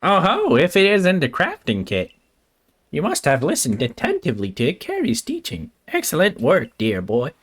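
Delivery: swooping, singsong professor voice; lilting cartoon scientist with wide pitch swings; Doofenshmirtz-like professor. Streamer voicing the game's professor praising a pupil, swooping cartoon voice. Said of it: "it kinda sounded like the witch voice".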